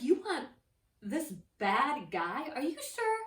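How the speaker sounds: loud, high-pitched incredulous questioning; shocked disbelief. Acted. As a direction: act out a shocked Pilate. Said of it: "Pilate was shocked".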